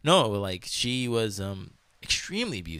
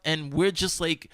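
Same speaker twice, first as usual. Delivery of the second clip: normal talking voice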